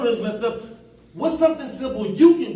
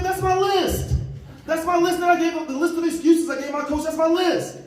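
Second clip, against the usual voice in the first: stunned realization; 6 semitones higher than usual. Real, not acted. Speaker realizing the prisoner's excuses match his own, high and amazed. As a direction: hit home that these are his own excuses. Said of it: "realizing the excuses were his".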